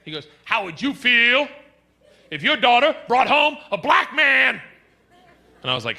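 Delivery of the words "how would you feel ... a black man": loud, high, emphatic question; pointed challenge. Retold or imitated. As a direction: imitate a friend throwing down a challenge. Friend's challenge, acted out.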